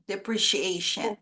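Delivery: "Depreciation" repeated carefully, stress on AY; correct pronunciation. Shown as a demonstration. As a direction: model "Depreciation" with a clear "shee" sound. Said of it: "this word is pronounced as depreciation".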